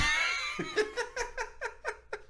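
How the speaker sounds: a burst of high squealing laughter; high shrieking laugh. Real, not acted. Laughing at his own joke, a high shriek.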